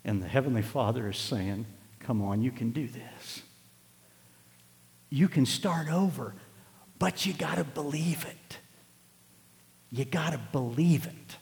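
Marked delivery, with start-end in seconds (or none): 2.0-11.4 softer, slower, coaxing reassurance; gentle encouragement. Acted. Heavenly Father encouraging a struggling believer, soft and slow. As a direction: talk him gently into starting over.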